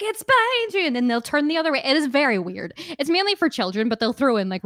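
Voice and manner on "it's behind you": high shouted warning; panto scream. Retold or imitated. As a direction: yell it at the stage. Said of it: "to scream, like".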